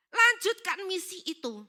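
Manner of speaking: loud, high acted order; forceful command. God tells grieving preacher to continue the mission, commanding. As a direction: push it out as a firm divine command. God's command in retold speech.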